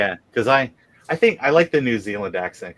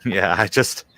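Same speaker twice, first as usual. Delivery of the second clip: usual speaking voice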